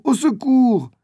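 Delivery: high, desperate repeated shout; the same boy still screaming for help under the claws; cry for help. A narrator's reading. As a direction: call out again in panic. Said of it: "Il entend quelqu'un crier".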